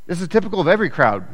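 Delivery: usual speaking voice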